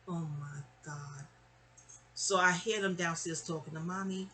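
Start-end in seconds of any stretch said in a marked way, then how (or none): 0.1-1.3 slow, soft retold dread; sinking dread. Girl realizing her aunt knows about the coat, quietly dreading it. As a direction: draw it out quietly as the trouble sinks in. Reliving dread of being found out.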